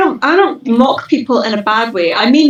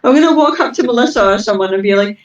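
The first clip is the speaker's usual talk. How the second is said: normal talking voice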